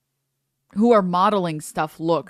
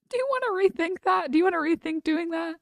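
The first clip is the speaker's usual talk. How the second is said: put-on patronising baby-talk voice; mock gentle scolding; 7 semitones higher, faster than usual. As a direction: talk down to him like a toddler. Mocking an edgy streamer.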